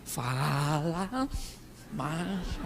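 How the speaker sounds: very soft and low, words drawn out and rising; hammed-up hush, stretching each word; exaggerated husband hushing. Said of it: "seu marido fala com você assim".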